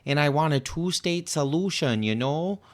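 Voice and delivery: lightly hammed-up Michigan twang; mock Michigan accent. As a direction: mimic an evasive candidate in an overdone Michigan accent. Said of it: "what exactly is she saying of substance".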